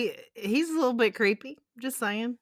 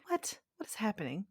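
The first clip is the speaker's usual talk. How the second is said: whisper; 2 semitones higher, 12 dB quieter than usual. Retold or imitated. Amazed reaction, retold as whisper.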